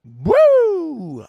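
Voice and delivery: loud, high "Woo!" cut loose; sudden whoop. Retold or imitated. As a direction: let out one wild whoop. Sudden shout in drunk-driving story.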